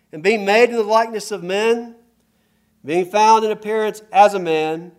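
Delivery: full-force sermon voice; fervent preaching. Acted. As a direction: drive the point home to the congregation.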